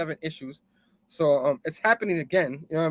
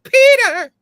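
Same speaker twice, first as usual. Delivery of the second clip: a very high, loud shout of his name; desperate call across the fight; scream; 13 dB louder than usual. Acted. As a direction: yell for him in panic.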